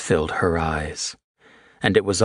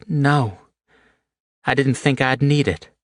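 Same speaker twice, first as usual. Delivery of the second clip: Haley's voice; 7 semitones higher than usual. A narrator's reading. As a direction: voice the injured woman, raised pitch.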